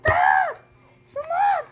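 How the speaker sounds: exasperated high shout; high frustrated yell. Pretend. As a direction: shout at them to stop. Yelling at friend on boat.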